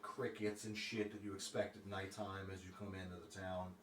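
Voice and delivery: ordinary delivery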